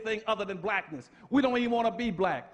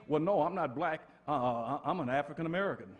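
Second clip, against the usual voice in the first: much lower, softer and fast with a laugh; mocking evasive answer; 6 semitones lower, 3 dB quieter than usual. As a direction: mumble the excuse like you're embarrassed. Mocked claim, laughing.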